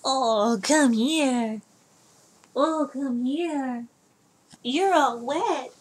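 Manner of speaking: high, slow cooing pet voice. Real, not acted. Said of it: "I talk to my cats like they're children".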